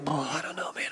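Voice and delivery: whispered, no voice at all; a nervous musician whispering before going on stage; sickly whisper. Retold or imitated. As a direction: whisper it like you feel queasy before a show. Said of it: "I was always like".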